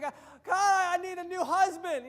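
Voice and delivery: hammed-up wail; begging God for a husband, hammed-up; mock plea.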